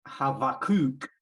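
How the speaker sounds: Havakuk said hah-vah-KOOK, with a V, stressed on the last syllable; slow ha-va-KUK, V sound, last syllable stressed; Hebrew pronunciation. Shown as a demonstration. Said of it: "with a V ... instead of a B".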